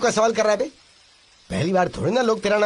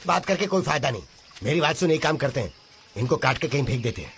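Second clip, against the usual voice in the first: lower, softer scheming aside; conspiratorial undertone; 9 semitones lower, 6 dB quieter than usual. Acted. Villager plotting to get rid of the two captives, conspiratorial. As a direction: drop the voice and propose it confidentially.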